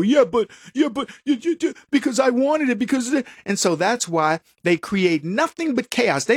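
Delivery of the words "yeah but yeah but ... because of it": higher voice, stammering out a self-justifying excuse; lightly mocking whiny excuse; mock excuse-maker. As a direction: mimic someone fumbling for a justification. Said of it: "and they go".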